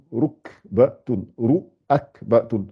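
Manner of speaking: "Rukbatun, ruakbatun" slowly, broken into syllables; syllables separated. Shown as a demonstration. Teacher separating the letters for learners, slow syllable by syllable. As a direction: sound out "Rukbatun, ruakbatun" piece by piece. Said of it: "je vais essayer de séparer les lettres".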